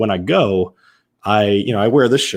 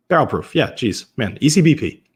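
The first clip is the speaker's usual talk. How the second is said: normal talking voice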